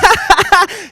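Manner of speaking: high mock cackle at own joke; self-satisfied laugh. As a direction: laugh as if thrilled by your own wit. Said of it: "I thought I was brilliant".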